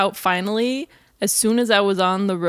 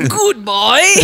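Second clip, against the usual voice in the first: loud British goodbye through laughter; hammed-up British accent, pitched up; mock British accent; 4 semitones higher, 9 dB louder than usual. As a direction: belt out a laughing fake British farewell. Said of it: "in a British accent".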